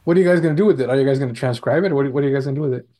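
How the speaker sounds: normal talking voice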